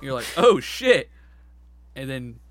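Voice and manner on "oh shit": loud, shocked outburst; startled exclamation. Retold or imitated. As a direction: blurt it out in surprise. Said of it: "that's you exclaiming".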